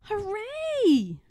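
high, bright cheer of delight; little bear shouting hooray; joyful cry. A narrator's reading. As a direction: cheer it out like a happy cub. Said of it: "cried Little Bear".

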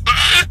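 short loud high shriek; giddy high yelp; excited squeal. Real, not acted. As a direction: squeal with glee. Excited squeal after saying so.

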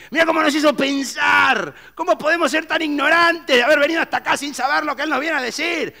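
high loud awestruck shouting; excited shout. Acted. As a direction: shout your amazement to the crowd.

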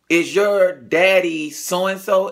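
a hint of the cousin, slow and probing; slow quizzing cousin. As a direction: ask it slowly, as if piecing it together. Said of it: "he was just like".

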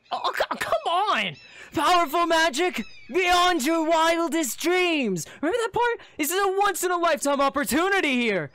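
high, loud, overblown coaxing; exasperated pleading. Acted. Cloaked figure begging you to reconsider, exasperated. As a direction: plead incredulously, drawing out the big words.

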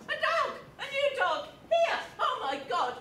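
high, loud hysterical exclamations; panicked cry. Acted. Woman raises the alarm over a dog, panicked cry. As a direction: shriek it out in a flap.

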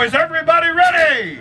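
high, loud call to the crowd; rally the crowd with a big shout; shouted question. Real, not acted. Host fires up the fair crowd with a shout. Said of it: "Y'all can do better than that".

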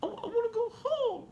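put-on very high, choked little-girl plea; imitating tearful child. Dad retells sick daughter in hospital wanting home, high tearful voice. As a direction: imitate a daughter holding back tears as she pleads. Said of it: "swallowing it to try to hold it back".

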